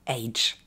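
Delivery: AGE said as a full English word, like "aydge"; the ending AGE over-pronounced as "aydge"; French learners' mispronunciation. Shown as a demonstration. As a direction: say AGE with a long "ay" as francophones wrongly do. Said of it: "Le défaut principal des francophones, c'est de le prononcer".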